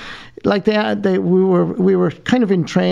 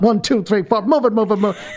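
army drill sergeant; 4 semitones higher than usual. Retold or imitated. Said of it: "one of those guys".

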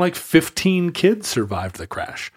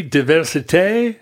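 French pronunciation; 3 semitones higher than usual. Shown as a demonstration. Mock French version of diversity.

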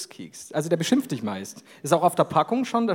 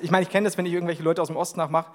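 normal talking voice

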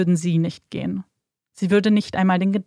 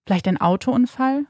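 ordinary delivery

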